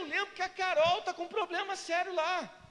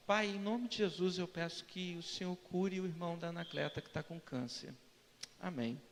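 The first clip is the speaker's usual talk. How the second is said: low, soft, unadorned prayer; plain quick prayer; 8 semitones lower, 6 dB quieter than usual. Acted. Pastor praying simply for a churchgoer's sick brother, plain prayer. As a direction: murmur a simple request to God, no flourish. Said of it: "eu falo assim".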